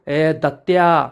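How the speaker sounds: slow, drawn-out annoyed call. A narrator's reading. Dhondi Anna addressing Datta who taunted him, mildly annoyed. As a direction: narrate a mildly angry man calling out a name, stretched. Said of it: "Dhondi अण्णा चिडून म्हणतो".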